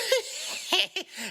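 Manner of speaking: a quick giddy critter laugh; high cartoon laugh. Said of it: "Rocket laughs along with Groot".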